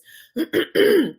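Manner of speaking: loud throat clear. Real, not acted. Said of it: "I'm losing my voice".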